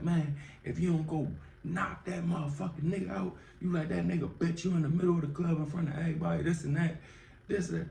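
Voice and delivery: high, fast egging-on voice; high fast hyped-up taunt; imitating hype cousin. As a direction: goad him into fighting. Cousin hypes him to fight.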